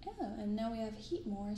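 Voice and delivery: ordinary talk, nothing marked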